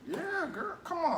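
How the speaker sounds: high excited. Real, not acted. Sassy agreement about collard greens.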